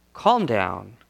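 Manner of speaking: low, settled, unhurried command; calm reassurance. Retold or imitated. Preacher voicing Jesus who has power over it, calm and low. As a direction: say it low as one in control.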